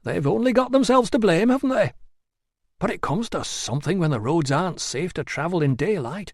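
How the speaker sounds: a hint of a fretful local; gossiping local. A narrator's reading. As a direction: tut over the news like a village gossip. Gossiping townsperson voiced.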